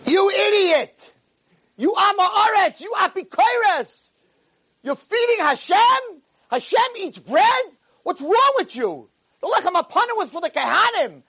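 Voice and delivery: acted indignant tirade; furious scolding. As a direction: berate him like an outraged rabbi. Rabbi berates the naive man.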